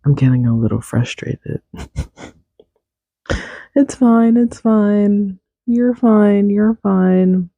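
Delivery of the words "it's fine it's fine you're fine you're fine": self-reassurance. Real, not acted. Reassuring herself after mic frustration.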